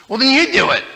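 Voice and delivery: very high, loud comeback; defiant kid. Retold or imitated. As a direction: throw it back at big brother. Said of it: "I said".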